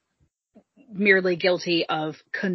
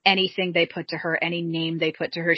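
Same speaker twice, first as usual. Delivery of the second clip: ordinary talk, nothing marked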